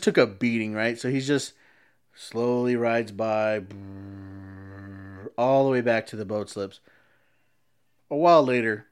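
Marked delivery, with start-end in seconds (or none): 3.6-5.3 soft low lip-trill motor sound; a sad little jet ski puttering off; mimicked engine putter. Jet ski idles slowly away.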